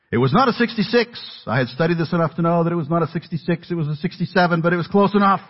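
high-pitched, eager rush of excitement; excited teenager. Retold or imitated. Sixteen-year-old spotting his dream Mustang, excited. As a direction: gush about the car of your dreams.

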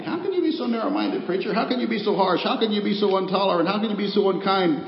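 mock critics. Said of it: "there's a lot of dismay".

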